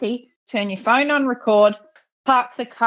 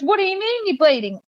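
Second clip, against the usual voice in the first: higher mock bewildered question; imitating his confusion; 10 semitones higher than usual. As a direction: play him baffled, pitched up. Imitating confused old man.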